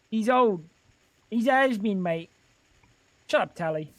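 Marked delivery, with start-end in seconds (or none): 0.1-2.3 bad British accent. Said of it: "the shit British accent".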